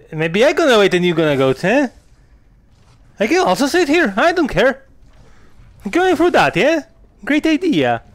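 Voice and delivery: petulant mock complaint; sarcastic mock whine. Real, not acted. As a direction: tease her sulkily. Mocking the companion who won't go.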